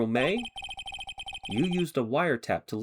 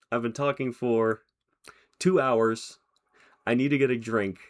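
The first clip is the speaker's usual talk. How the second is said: ordinary talk, nothing marked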